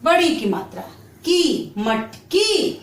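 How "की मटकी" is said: की, मटकी joined very slowly; slow blending. Shown as a demonstration. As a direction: blend the syllables slowly into the word. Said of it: "अब इसे हम कैसे बांटेंगे?". (शिक्षिका धीरे-धीरे मटकी जोड़कर बोलती है)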